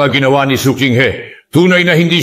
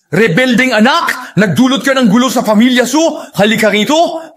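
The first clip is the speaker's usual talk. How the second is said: higher-pitched, stern parental reprimand; angry scolding; 5 semitones higher than usual. Acted. Elder berating the rebellious child for troubling the family, angry scolding.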